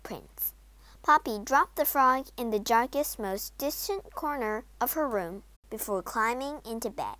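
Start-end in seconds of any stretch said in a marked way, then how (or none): none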